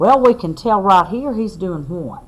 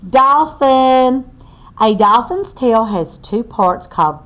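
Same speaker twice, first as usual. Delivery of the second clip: slow, loud read-aloud to a class; reading to children; 6 semitones higher, 7 dB louder, slower than usual. Acted. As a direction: spell it out clearly for little listeners. Reads book text aloud.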